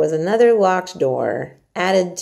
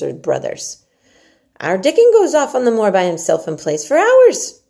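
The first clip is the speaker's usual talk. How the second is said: Yorkshire maid Martha; 4 semitones higher, 6 dB louder than usual. Acted. Martha urges Mary to eat her porridge, hearty and coaxing. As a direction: coax the child like a bossy country servant.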